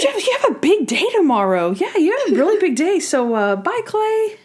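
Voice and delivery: high-pitched, chirpy send-off; mock brush-off. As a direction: shoo a suitor away in a high voice. [name] shooing Clay away, acted.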